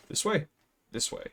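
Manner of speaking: usual speaking voice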